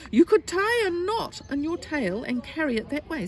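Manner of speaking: put-on high, eager child's suggestion; bright-idea little boy. Little boy offering tiger an idea, high and eager. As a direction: pipe up with a clever solution.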